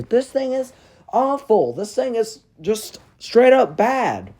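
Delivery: high, emphatic, slow-punched disgust; exasperated rant. Real, not acted. Reviewer condemning a prop to viewers, exasperated rant. Ranting about a hated prop.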